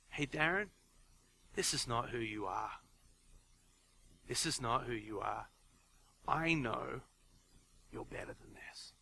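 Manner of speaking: low, soft, earnest words fading to a whisper; quiet earnest teacher. Retold or imitated. Teacher pulling pupil aside, quiet and earnest. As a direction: lean in and speak softly, eye to eye. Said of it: "he looked me in the eye, and he said".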